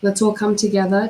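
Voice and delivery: normal talking voice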